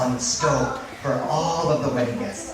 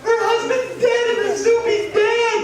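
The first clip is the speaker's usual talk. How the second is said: very high, loud wail; hammed-up tearful howl; mock crying dog; 4 dB louder than usual. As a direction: bawl the news theatrically, way up high. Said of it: "why are you crying?".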